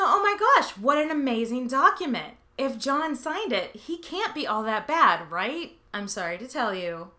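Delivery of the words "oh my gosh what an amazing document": higher, louder fake rapture over the charter; mock gushing. Mock thrill at the famous charter, gushing. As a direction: gush like a starstruck fan.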